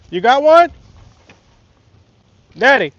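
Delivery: loud, high call across the water. Real, not acted. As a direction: holler to your dad across the water. Calling out to daddy fishing.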